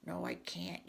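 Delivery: low, stubborn storybook refusal. Acted. Gerald refuses to be a frog, low stubborn retort. Back-and-forth story quarrel.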